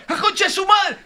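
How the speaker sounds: high-pitched shouted curse of release; grito de gol. Shown as a demonstration. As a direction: yell it out as if your team just scored. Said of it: "cómo se gritan los goles en la cancha". (puteada gritada de gol)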